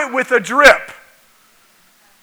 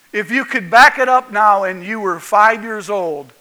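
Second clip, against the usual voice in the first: ordinary talk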